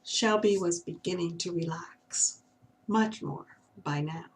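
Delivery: ordinary delivery, nothing marked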